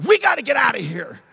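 urgent loud warning to Bruce. Retold or imitated. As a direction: hurry your buddy out, loud and high. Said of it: "I said to my buddy Bruce".

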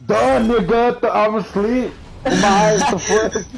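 high, loud mock-sarcastic reply with a laugh; sarcastic retort. Telling how he answers his mom: loud, high, laughing sarcasm. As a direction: snap back at mom, laughing through it.

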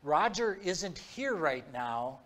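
slow, halting retold phone reply; imitating a hesitant voice on the line. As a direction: stall between phrases, as if unsure of the cover story. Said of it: "very hesitantly said".

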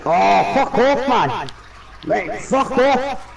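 drawn-out outraged yell; angry shout. Real, not acted. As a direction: let it rip, stretched and high. Teammate killed, shouting protest.

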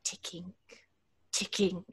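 breathless whisper, no voice; haunted whisper. Acted. Florence whispering to herself about the clock. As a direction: whisper it, hollow and numb.

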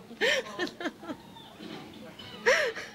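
drawn-out squealing laugh; long high laugh. Real, not acted. Recalling refusing the healer, long high laugh. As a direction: let the laugh run on, high.

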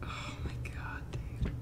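voiceless whisper, very soft; terrified hushed whisper; scared whisper. Real, not acted. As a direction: whisper so it can't hear you. Hiding from monster in game.

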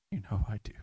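tender whispered answer to Jesus; whispered reply. Real, not acted.

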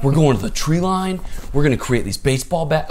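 loud, high-pitched eager plotting; excited scheming. Retold or imitated. Kids planning to arm themselves, loud and excited. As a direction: re-enact kids hatching a wild plan, loudly.

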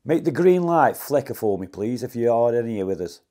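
loud plea to a ghost; calling out loud. Real, not acted. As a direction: call out to the room, insisting.

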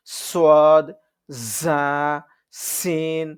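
letters with a hissing whistle on the s and z; whistling sibilant. Shown as a demonstration. Teacher drilling whistling letters for students, whistling sibilant. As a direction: let the sibilant whistle through each letter. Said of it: "শিস শব্দ".

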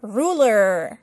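ruler in an American accent, full final r. Shown as a demonstration. Teacher contrasting American and London ruler. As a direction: sound the r at the end of American ruler. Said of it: "si fuéramos a América".

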